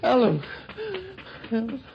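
high, anxious calls, the last one fading; frightened calling. Acted. Man calling his motionless girlfriend, frightened. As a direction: call the name hoping for any answer.

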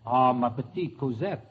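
low mock-French lover's murmur. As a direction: play a romantic Frenchman, French accent. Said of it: "this time you are Pierre Dumont".

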